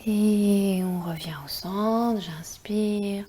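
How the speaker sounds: soft whispered breathing guidance; slow, hushed meditation voice; guided meditation. Acted.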